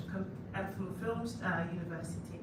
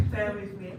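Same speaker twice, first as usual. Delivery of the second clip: laughing; 9 dB louder than usual. Real, not acted. Nervous speaker laughs at herself.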